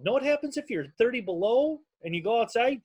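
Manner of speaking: ordinary delivery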